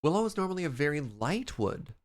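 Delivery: ordinary delivery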